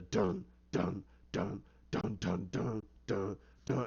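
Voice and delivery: low, soft "dun" beats; spaced low drum-like duns; imitated ominous theme music. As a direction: sound out a menacing score. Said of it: "that's when you get his music".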